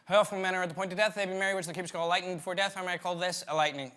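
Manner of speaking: gabbled very fast, without feeling; rushed Shakespeare. Acted. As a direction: race through the lines like a nervous student. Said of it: "No, no, no".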